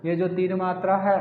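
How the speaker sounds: normal talking voice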